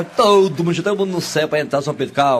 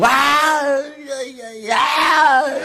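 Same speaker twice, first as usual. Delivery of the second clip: high, strained hammed-up wails of pain; pained cries; 11 semitones higher, much slower than usual. Neighbour's husband suddenly feeling labor pain, hammed-up high wails. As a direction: howl as if the labor pain hit you.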